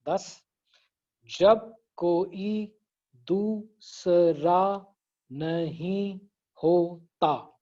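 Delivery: slow, syllables counted out one by one. Shown as a demonstration. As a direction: beat out each syllable slowly. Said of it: "fingers को हमेशा".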